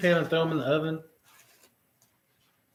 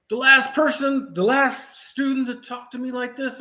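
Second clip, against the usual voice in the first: mocking the trainer; 8 semitones higher than usual. Mocking the old trainer who went off on him, higher and whiny. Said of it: "he decides to go off on me".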